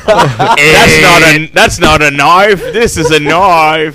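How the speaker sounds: on-air request for a try, a hammed-up bad Australian accent. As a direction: ham up a Crocodile Dundee Australian accent. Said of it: "Can we hear on air attempt?".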